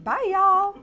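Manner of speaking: high, loud drawn-out goodbye; mock farewell. Host imitates Caitlin leaving the show, loud mock farewell. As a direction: call out a big farewell as someone leaving. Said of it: "She's like".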